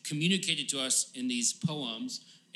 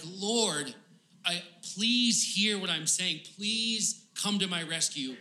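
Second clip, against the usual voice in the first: higher, earnest plea for rescue; pleading; 4 semitones higher than usual. Acted. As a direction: beg the Lord, stretching the pleas. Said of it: "he's pleading with the Lord".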